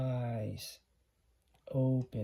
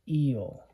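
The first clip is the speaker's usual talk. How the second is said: Eel with the long E leaned on, louder; stressed long E; 6 dB louder than usual. Shown as a demonstration. Teacher showing the long vowel to the class, stressed Eel. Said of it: "You're really stressing that E".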